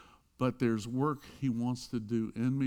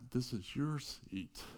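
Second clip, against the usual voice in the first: much softer, slow and unassuming; quiet private words; 10 dB quieter, slower than usual. Retold or imitated. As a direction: say it so only she hears, slowly. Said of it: "nobody heard him say that".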